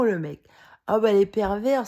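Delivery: normal talking voice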